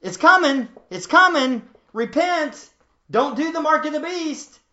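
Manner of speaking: loud, high urgent proclamation; angels' warning. Retold or imitated. Preacher voicing the warning angels, loud and urgent. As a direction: cry the warning out like a herald. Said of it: "The angels were saying what?".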